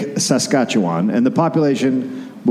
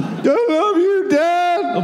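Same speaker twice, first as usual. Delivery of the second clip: put-on much higher, slow, sugary little kid to dad; mock sweet child; much slower than usual. Teasing his cohost as a doting child, much higher and slow. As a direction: coo it up high like a doting child. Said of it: "What is wrong with you?".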